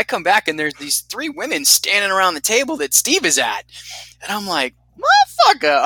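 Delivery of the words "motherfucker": high, loud surprised exclamation; delighted disbelief. Retold or imitated. Seeing women around his buddy's table, a high loud amazed curse. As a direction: blurt it out in amazed glee.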